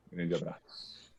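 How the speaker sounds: usual speaking voice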